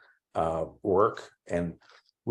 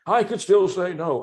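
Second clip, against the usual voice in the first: higher and louder, an indignant comeback; bishop's stung reply, pitched up and louder; retold angry retort; 11 semitones higher, 6 dB louder than usual. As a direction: snap it back like a bishop who has just been offended. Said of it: "Well, that infuriated me".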